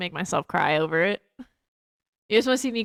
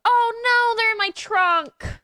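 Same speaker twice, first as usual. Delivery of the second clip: high-pitched dismay, slowing down; sudden dismay at the trunk; 13 semitones higher, slower than usual. Real, not acted. Streamer realizing her Coca-Colas are in the trunk, high dismayed oh no.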